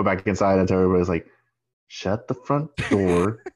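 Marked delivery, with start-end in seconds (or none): 1.9-3.4 slow, drawn-out party orders; emphatic orders. Retold or imitated. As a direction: stretch out each order to the room. Host giving orders to party guests.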